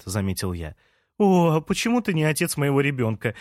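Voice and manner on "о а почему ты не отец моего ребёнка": mild, higher-pitched exclamation; exclaiming. A narrator's reading. Лея to the neighbour who allows her coffee, exclaiming. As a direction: exclaim it as a sudden playful thought. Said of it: "воскликнула Лея".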